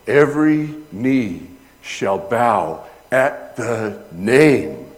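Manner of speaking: preaching climax. Acted. Preacher proclaiming to the congregation, slow and loud. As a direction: proclaim it like a herald.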